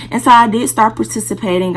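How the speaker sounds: usual speaking voice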